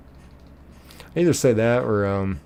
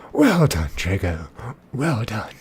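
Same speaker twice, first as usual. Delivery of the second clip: Lord Voldemort; slower than usual. Retold or imitated. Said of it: "he's acting like Lord Voldemort".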